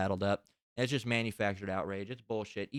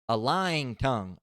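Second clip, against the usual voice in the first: louder, slow emphatic reading; scripture reading; 6 dB louder, slower than usual. Acted. Reading the list of hated things, slow and emphatic. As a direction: weigh out "a lying tongue". Scripture phrase, first take.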